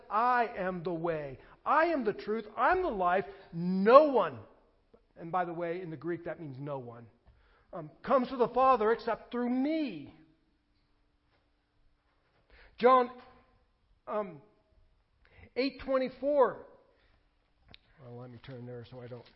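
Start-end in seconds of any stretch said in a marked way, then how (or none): 0.1-4.4 loud, high, full-force preaching; fiery preaching. Acted. Quoting Jesus, preaching. 8.0-16.6 loud, high, full-force preaching; fiery preaching. Acted.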